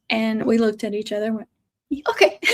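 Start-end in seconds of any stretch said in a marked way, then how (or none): 2.1-2.4 a sudden high, bright "Okay"; giddy okay. Retold or imitated. Couple suddenly agreeing to take the puppy, high and giddy. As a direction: jump at it, half surprised.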